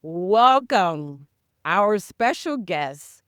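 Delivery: normal talking voice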